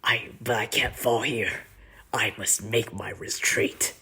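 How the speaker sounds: half-whispered stubborn villain; breathy, solemn retreat line; grave villain refusing to fall; Hubert impression. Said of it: "he says".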